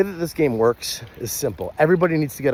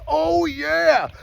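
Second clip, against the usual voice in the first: hyped oh yeah; 13 semitones higher than usual. Shown as a demonstration. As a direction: celebrate with your partner. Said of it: "You say".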